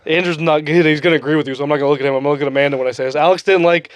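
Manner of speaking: ordinary talk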